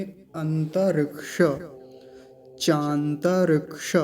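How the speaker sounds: चांतरिक्ष slowly, with च and a full क्ष; slow full form. Shown as a demonstration. Teacher modelling the Sanskrit form slowly. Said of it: "लेकिन यहाँ ये पूरा है".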